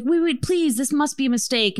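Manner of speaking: high-pitched imitated urgency; retold pleading disbelief; disbelieving plea. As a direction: play the flustered owner calling someone back. Acted owner chasing the customer.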